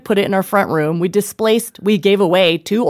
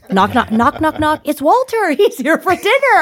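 lilting, rising knock knock; a cheery guest arriving, pitched up; high, singsong knocking; 8 semitones higher than usual. Retold or imitated. Mimicking the door and the guest.

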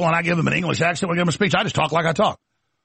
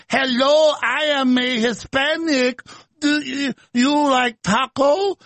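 slow, high, exaggerated Spanish accent; 7 semitones higher, slower than usual. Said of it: "she gets in front of a group of Hispanics".

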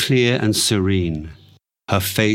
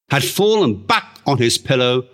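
plain reading voice

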